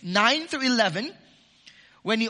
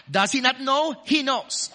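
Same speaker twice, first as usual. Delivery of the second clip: usual speaking voice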